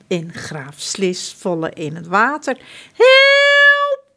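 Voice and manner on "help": high, loud shriek; shrieked help. Acted. Prince John falling into the water, shrieking. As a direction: scream it like a panicking prince. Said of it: "gilt prins John".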